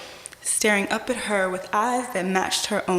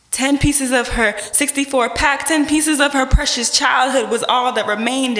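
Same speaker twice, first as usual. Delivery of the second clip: higher and louder, building; rising intensity; 4 semitones higher, 5 dB louder than usual. Acted. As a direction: push it out with force. Climax of spoken word poem.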